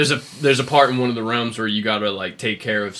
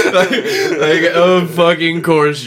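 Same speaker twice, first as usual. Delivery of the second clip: laughing; 7 semitones higher, 10 dB louder, slower than usual. Real, not acted. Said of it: "laughing at Kratos joke".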